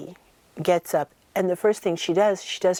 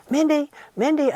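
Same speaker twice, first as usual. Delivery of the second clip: high, louder call through a bathroom door; calling out a name; 9 semitones higher, 3 dB louder than usual. Retold or imitated. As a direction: call her name to get her attention.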